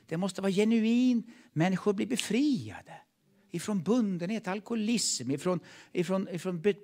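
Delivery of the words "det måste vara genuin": ordinary delivery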